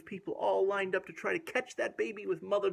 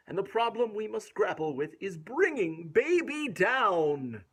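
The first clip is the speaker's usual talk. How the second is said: put-on lilting read-aloud rhythm for a child; rhymed story reading; 6 dB louder than usual. A narrator's reading. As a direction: bounce through the rhyming verse. Rhyming verse continues.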